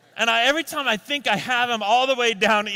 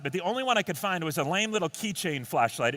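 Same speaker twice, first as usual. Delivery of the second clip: ordinary talk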